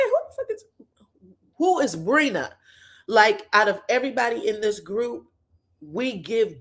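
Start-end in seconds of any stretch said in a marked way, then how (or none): 0.0-2.5 sudden high outraged "who the fuck is"; mock-incredulous demand, pitched way up; imitating a friend's disbelieving snap; indignant retort. Toria's outraged retort to Chloe.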